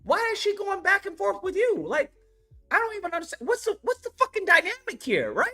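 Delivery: very high, incredulous squeal; squeaky disbelief. Retold or imitated. His reaction to the engineer, a very high incredulous squeal. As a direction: squeal in outrage. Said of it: "I'm like".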